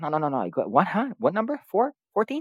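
hammed-up confused magician; playing dumb magician. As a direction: act lost while fully in control. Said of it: "that bit where he's like".

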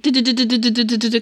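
fast rattling gun noise; machine gun noise. Retold or imitated. Imitating machine gun fire.

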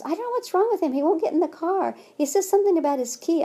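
high, fretful imitation of the worker; flustered worker. New worker reports upset child.

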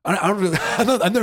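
high, laughing words. Real, not acted. Laughing at Jeff overthinking his city name. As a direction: laugh while you answer.

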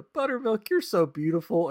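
put-on high-pitched adoring kid voice; cartoon kids. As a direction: coo at the pony like a cartoon child.

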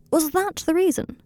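high-pitched, startled outcry; crying out. A narrator's reading. Draco learns Blaise's reason from Harry, crying out. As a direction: exclaim it, pitch leaping up. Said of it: "cried Draco".

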